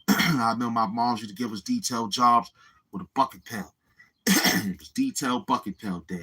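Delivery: usual speaking voice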